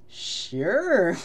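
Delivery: high, stretched comic "sure"; imitated awkward yes; drawn-out agreement. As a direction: agree with a long swooping word. Acts out reply to interview offer.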